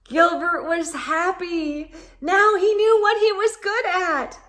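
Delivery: light up with delight; joyful. A narrator's reading. Narrator rejoices for Gilbert.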